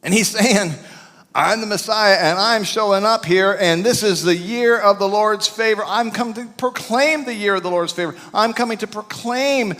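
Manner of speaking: high, loud, rousing sermon delivery; full-force preaching. Acted. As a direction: preach it to the back row. Preaching the good news list.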